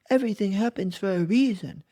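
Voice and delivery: high, fast, sing-song mocking of a cliché. Shown as a demonstration. Poking fun at the cliché everything happens for a reason, mock voice. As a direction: sound like a shallow cliché-spouter.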